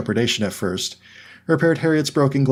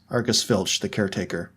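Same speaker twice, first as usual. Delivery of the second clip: ordinary delivery, nothing marked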